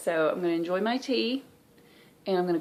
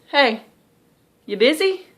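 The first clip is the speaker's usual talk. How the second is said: uncle on the phone; 7 semitones higher, 10 dB louder than usual. Retold or imitated. Voicing uncle calling mom.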